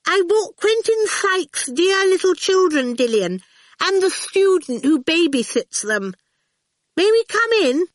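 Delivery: high-pitched carrying social call; calling back. A narrator's reading. Miss Potter announcing the children to Dillion, calling back. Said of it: "Miss Potter called back".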